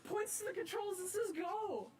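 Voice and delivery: high, breathless, giddy laughter through the words; laughing excitement. Real, not acted. Rail fan telling the camera after driving the engine, giddy laughter. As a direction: gush it out while laughing.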